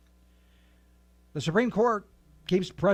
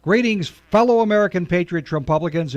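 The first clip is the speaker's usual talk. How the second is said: much louder, announcer-style opening; booming show greeting; 10 dB louder than usual. Acted. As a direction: hail the listeners at full volume. Host opens the radio show.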